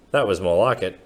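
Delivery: plain reading voice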